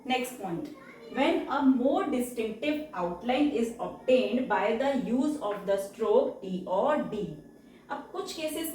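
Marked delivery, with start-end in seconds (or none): 1.1-7.3 slow rule dictation. Shown as a demonstration. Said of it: "reads rule slowly".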